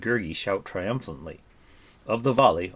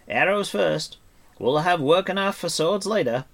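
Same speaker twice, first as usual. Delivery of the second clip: higher, louder bard's orders; rally the archers with raised voice; Fluter the bard, commanding; 9 semitones higher, 6 dB louder than usual. Acted. Bard readies companions for battle, commanding.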